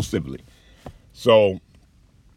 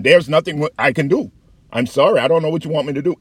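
quick, higher, louder brush-off; dismissive advocate; 7 semitones higher, 5 dB louder, faster than usual. Retold or imitated. Patient advocate brushing off a complaining veteran, mock dismissive. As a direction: rattle it off to get rid of him.

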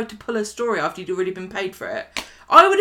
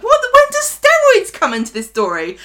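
incredulous; 14 semitones higher, 8 dB louder than usual. Retold or imitated. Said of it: "I'm like".

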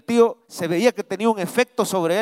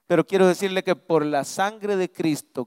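ordinary delivery

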